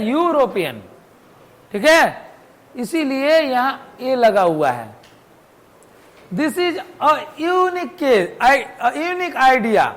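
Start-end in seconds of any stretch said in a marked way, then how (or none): 6.3-9.9 slow, word-by-word example sentence; slow example reading. Shown as a demonstration. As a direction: spell it out slowly for students. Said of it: "unique जो होता है, यह भी य से शुरू है". (धीरे-धीरे शब्द-दर-शब्द पढ़ना)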